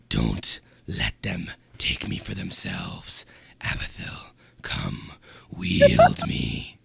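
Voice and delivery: slow low whisper building to a shriek; demon voice. Acted. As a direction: tempt him, then scream the command. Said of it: "He sounds like a demon".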